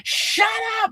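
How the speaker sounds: high-pitched imitated yell at the crowd; mock scream. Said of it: "she was screaming at the audience".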